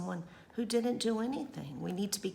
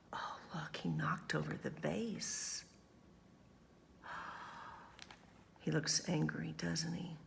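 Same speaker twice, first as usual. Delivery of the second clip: whisper; 2 semitones lower, 9 dB quieter than usual. Real, not acted. Teacher whispers aside to children.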